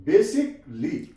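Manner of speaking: basically said slowly as बेसिकली with no a before the l; silent l. Shown as a demonstration. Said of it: "ये एल silent है यहाँ पे". (basically धीरे, एल silent)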